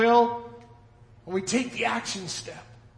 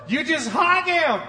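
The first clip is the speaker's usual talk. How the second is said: mock sincere; 7 semitones higher, 5 dB louder than usual. Sarcastic joke about wives.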